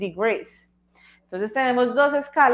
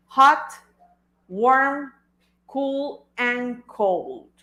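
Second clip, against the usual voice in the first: teacher recapping the four words; listing emphasis; much slower than usual. Shown as a demonstration. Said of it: "teacher listing the four words".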